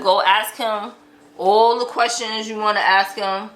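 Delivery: ordinary delivery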